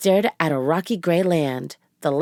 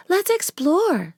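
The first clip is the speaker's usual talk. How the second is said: put-on high, slow eager girl; 12 semitones higher, slower than usual. A narrator's reading. Narrator voices Annie.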